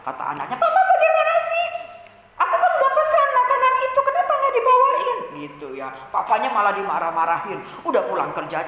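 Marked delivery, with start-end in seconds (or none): 0.6-5.2 very high, loud squealing complaint; acted shrill tantrum; angry child. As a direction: scold papa like a furious little kid. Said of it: "si anak ini marah".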